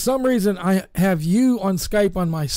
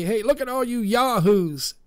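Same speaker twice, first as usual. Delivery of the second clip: Southern twang; 4 semitones higher than usual. Retold or imitated. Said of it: "That sounds like a Southern Alabama twang there".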